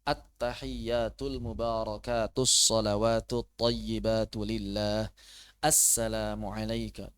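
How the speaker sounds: slow, careful, every syllable given its length; slow correct reading. Shown as a demonstration. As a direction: read it properly, unhurried. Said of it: "Nah, begitu bacanya ya". (bacaan tahiyat pelan dan benar)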